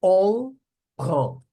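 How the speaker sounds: "On" loud and high, then "prend"; silent final consonant. Shown as a demonstration. Teacher showing the unpronounced ending, emphatic French demo. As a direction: separate "On prend", ending on the nasal vowel. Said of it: "Não pronuncia o final".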